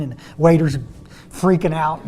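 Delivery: normal talking voice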